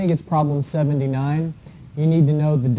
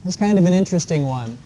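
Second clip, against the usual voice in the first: usual speaking voice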